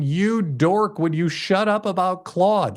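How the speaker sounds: ordinary talk, nothing marked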